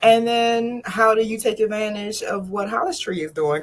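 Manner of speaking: normal talking voice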